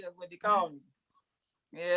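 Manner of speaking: ordinary talk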